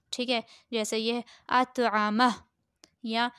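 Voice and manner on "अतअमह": अतअमह ending on a short breathed h; waqf stop ending. Shown as a demonstration. Teacher giving another example, अतअमह in pause form. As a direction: stop अतअमह at "mah", without the "hoo" vowel. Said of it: "वक्फ़ की सूरत में इसको".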